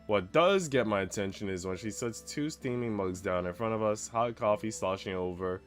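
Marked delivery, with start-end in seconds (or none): none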